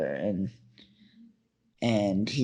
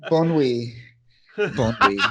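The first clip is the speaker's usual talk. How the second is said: lightly put-on French accent, mocking Wolverine; 3 dB louder than usual. Mocking Wolverine's French for a friend, lightly put-on French accent. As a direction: Bon nuit in a half-hearted French accent. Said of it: "Wolverine doing his best French accent".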